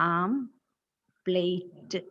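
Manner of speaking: omelette drawn out slowly as AAM... PLATE; common mispronunciation. Shown as a demonstration. Teacher reading out the faulty transcription, omelette slowly as AAM-PLATE. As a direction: split omelette into two slow parts, aam-plate. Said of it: "അതിന്റെ phonetic transcription ഇവിടെ ഉണ്ട്".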